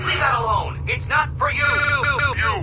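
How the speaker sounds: villain scolding, spelling it out; sharp warning. Acted. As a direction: warn him off through clenched patience. Riddler warning Batman off.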